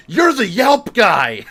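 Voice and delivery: loud, high, drawn-out cry of recognition; excited recognition. Retold or imitated. Woman in the store recognizing Jason, loud excited cry. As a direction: shout it out like spotting a celebrity.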